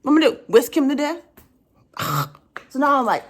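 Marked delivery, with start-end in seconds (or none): none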